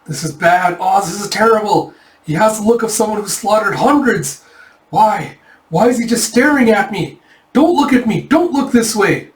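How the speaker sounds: high yelp into panicked babble; mock scream of panic. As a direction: play a coward panicking at a monster. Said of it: "And he screams out".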